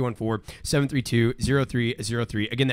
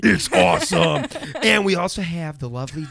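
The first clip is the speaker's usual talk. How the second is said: deep voice; 5 semitones higher, 4 dB louder than usual. Acted. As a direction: drag it out in a mock deep voice. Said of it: "we're doing deep voices now".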